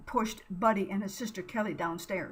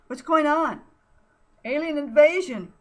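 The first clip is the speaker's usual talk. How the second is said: loud high panic; panicked shout; 7 semitones higher, 9 dB louder than usual. Acted. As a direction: yell it in terror. Panicked shouts in alien attack.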